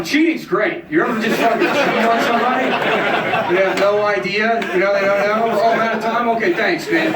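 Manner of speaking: high, loud hack comic voice; mocking comic. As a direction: ham up a bad open-mic set. Acted open-mic set.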